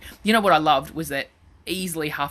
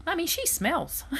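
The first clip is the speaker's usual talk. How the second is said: put-on much higher, softer little-kid voice; child pointing at mother's friend, higher voice; 13 semitones higher, 5 dB quieter, slower than usual. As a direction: imitate a small child, higher and hushed. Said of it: "this one little kid goes".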